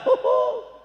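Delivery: squeaky, airy little laugh; high child's giggle. Acted. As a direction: giggle like a delighted kid. Child laughs at new money.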